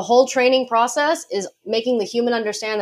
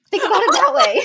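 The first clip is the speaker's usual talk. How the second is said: laughing; 14 semitones higher, 4 dB louder than usual. Real, not acted. Jokes about barking back at dog.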